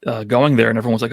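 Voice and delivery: ordinary delivery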